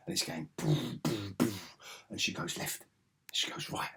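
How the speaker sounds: hushed, breathy play-by-play; whispered commentary. Real, not acted. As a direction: whisper it under your breath.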